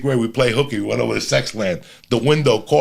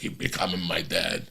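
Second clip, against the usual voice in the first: low mock wife remark; 7 semitones lower, 11 dB quieter than usual. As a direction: lower and soft, deadpan wife. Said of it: "She's like".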